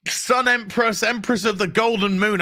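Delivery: normal talking voice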